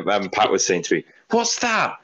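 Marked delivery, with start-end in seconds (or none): 1.3-2.0 high-pitched squeal of a question; retold startled question. Retelling a friend spotting something by the telly, high-pitched alarm. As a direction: imitate someone squeaking in alarm.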